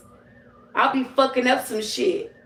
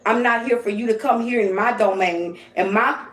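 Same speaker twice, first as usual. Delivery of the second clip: ordinary delivery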